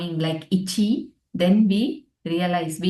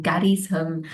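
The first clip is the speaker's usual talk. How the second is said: ordinary delivery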